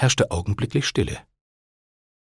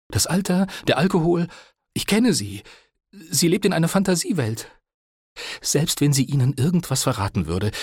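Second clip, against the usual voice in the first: earnest, higher-pitched persuasion; lightly voiced pleading nephew; 7 semitones higher than usual. A narrator's reading. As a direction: plead your case to a dangerous man.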